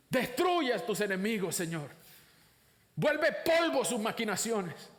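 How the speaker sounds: high, forceful plea to God; fervent psalmist's cry, punched at each opening word; imprecatory prayer. Acted. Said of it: "oraciones imprecatorias vienen de los salmos".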